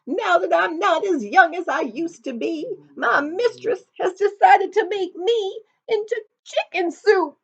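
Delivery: high, loud rooster voice; a desperate rooster making noise; ragged old rooster. Acted. Rooster voice in the story.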